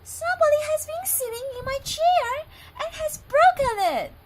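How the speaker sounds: very high, loud fast little squeak; Baby Bear squeaks. Acted. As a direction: squeak like a wailing tiny cub. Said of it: "squeaked in his little voice".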